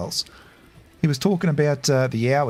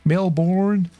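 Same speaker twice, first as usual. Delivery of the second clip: mock American Melbourne; 6 semitones higher than usual. Aussie imitating how foreigners say the city, American-style. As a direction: say "Melbourne" as "MEL-born", the way outsiders get it wrong. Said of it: "they say Sydney or Melbourne".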